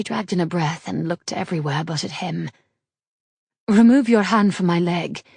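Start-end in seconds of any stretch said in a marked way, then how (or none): 3.7-5.2 louder, clipped demand to stop; firm order. A narrator's reading. Daughter ordering the smith off her leg, louder firm demand. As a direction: insist he take his hand away.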